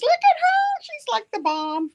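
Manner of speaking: squealing high with delight; gushing. Real, not acted. Showing off Debbie on camera, delighted squeal.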